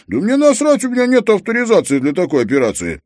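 high, loud cranky protest; grumpy old friend. A narrator's reading. Treska refusing Harry's urgent request, cross and high.

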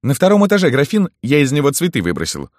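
high, loud henchman's voice; high-voiced errand runner; henchman Zhora. A narrator's reading. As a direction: pitch it way up as an underling reporting. Zhora voiced, reporting his errand.

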